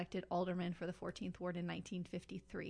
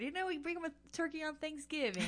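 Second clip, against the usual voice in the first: higher, fast, proud politician voice; imitating old-school alderman; 8 semitones higher, 3 dB louder than usual. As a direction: puff up like a ward boss bragging. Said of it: "it was called".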